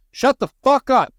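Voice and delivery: high, loud furious shout from the front seat; angry parent yell. Retold or imitated. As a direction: bark it at the kids to shut them up. Parent yelling at kids in car.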